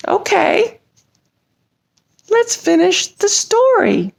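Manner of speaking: a lightly voiced high puppet, eager and pushy; chirpy puppet eager for the story. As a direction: nudge your friend to get on with it. Puppet voice urging reading.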